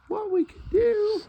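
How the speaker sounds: normal talking voice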